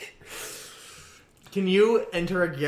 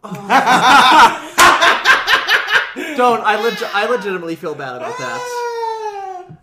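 laughing; 6 semitones higher, 9 dB louder than usual. Real, not acted. Laughing confession.

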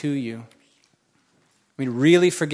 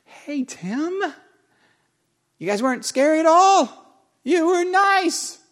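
high, sweet, louder mock voice, slow at the end; imitating church people; 13 semitones higher, 5 dB louder than usual. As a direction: parrot their cheerful reassurance in a squeaky voice. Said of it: "people said things like".

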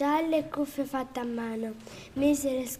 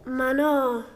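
protesting; 5 dB louder than usual. Acted. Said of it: "protesta il lupo".

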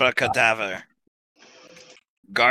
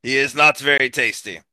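usual speaking voice

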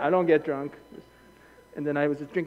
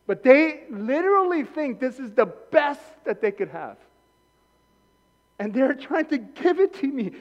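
rising to a passionate shout; fiery sermon building to a shout; preaching at full force; 13 semitones higher, 8 dB louder than usual. Real, not acted. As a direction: preach it louder and louder.

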